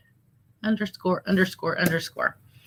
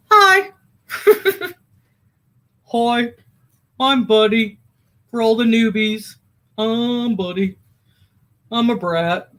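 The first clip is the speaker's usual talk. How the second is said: high little dog voice for Buddy; playful pet voice, a touch higher; voicing the dog; 4 semitones higher than usual. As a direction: speak as the dachshund introducing himself. Speaking as her dog Buddy.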